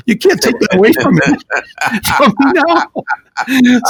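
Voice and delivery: playful, laughing through the words; laughing tease. Retold or imitated. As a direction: tease him warmly while laughing. Joking to the tearful stagehand.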